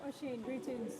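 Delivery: ordinary delivery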